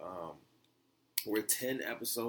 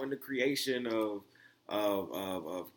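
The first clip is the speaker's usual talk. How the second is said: ordinary talk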